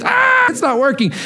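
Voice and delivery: high-pitched mock wail of distress; screaming child. Father mimicking his son over a broken toy, mock scream. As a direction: imitate an upset kid's scream, without full volume. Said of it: "he just starts screaming".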